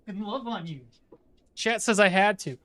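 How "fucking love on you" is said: tender to a pet. Real, not acted. Cuddling the dog softly.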